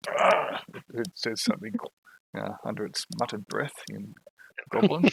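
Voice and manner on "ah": loud, voiceless snarl; annoyed growl. Acted. Spotted sneak reacting to the shot, annoyed growl. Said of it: "annoyed it's been spotted".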